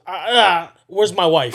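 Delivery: shouting at the friend; angry yell. Retold or imitated. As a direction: demand it loudly, furious.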